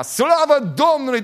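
loud, high, slow "Slavă Domnului!"; shouting praise. Retold or imitated. Churchgoer disturbing the pastor, loud shouted praise. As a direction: bellow the praise across the church. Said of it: "un oarecare care striga".

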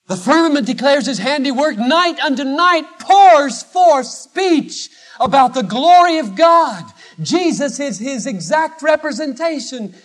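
soaring sermon climax; full-force preaching. Acted. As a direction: declare it like the heavens themselves.

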